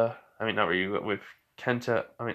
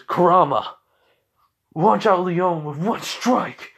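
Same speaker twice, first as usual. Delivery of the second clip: loud, high, drawn-out attack call; acted heroic battle cry; battle command; 6 semitones higher, 6 dB louder than usual. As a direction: shout the order like an anime hero.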